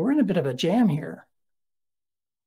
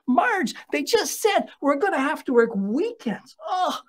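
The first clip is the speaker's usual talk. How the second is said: higher-pitched, exasperated griping at his wife; complaining to wife; 5 semitones higher than usual. Retold or imitated. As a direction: whine through a list of work grievances, pitched up. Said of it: "I'd complain to my wife".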